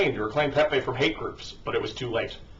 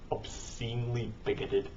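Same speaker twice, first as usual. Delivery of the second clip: quiet echo; 10 dB quieter than usual. Real, not acted. Commentator echoing the article's wording, quietly. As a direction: drop much softer to repeat the phrase. Echoes the article's phrase to himself.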